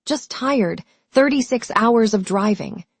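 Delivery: usual speaking voice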